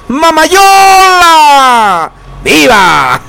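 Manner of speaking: high, very long drawn-out cry; slow, soaring cheer with a falling tail; festive viva shout. Acted. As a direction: stretch the name into a fiesta grito. Radio host calling out vivas.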